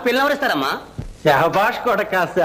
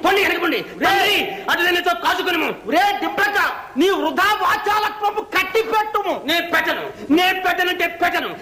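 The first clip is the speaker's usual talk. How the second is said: high, shouted theatrical battle challenge; stage villain shouting; 4 semitones higher than usual. Acted. Villain challenging his foe to battle in the play, shouting. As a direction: roar the challenge in archaic stage style. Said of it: "villain, villain".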